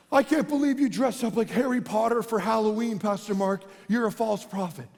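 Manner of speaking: put-on voice of an online accuser; mock scolding critic. Internet stranger berating the pastor, mock scolding. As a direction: imitate an indignant internet scold.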